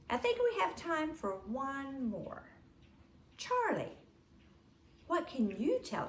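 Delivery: put-on teacher presenting the children to the congregation, sugary and high; sweet Sunday school teacher. A narrator's reading. Teacher calls on Charlie.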